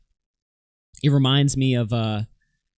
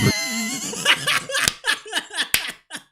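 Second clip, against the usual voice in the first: shrieking laugh. Real, not acted. Reacting to a claim in the clip.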